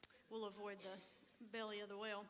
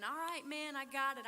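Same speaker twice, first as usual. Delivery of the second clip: louder, higher cocky warrior reply; boastful warrior; 4 semitones higher, 6 dB louder than usual. Retold or imitated. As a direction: brag like a mighty warrior who is eager for the fight. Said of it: "you would have been".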